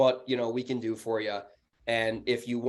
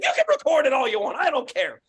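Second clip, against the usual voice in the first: high, loud defiant retort; freaking out; 13 semitones higher, 6 dB louder than usual. Retold or imitated. Landlord retells defying recorded line, angry high shout. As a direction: throw it back angrily, voice way up. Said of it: "I'm freaking out".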